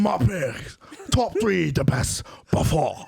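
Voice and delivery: high, hammed-up French accent. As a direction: overdo a cheesy French accent. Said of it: "French accent".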